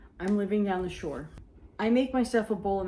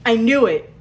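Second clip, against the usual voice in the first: much louder knowing "I knew it"; triumphant exclaim; 3 semitones higher, 13 dB louder than usual. Retold or imitated. Sister after the wedding, loud and knowing. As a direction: nail it like you caught her out. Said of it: "She goes".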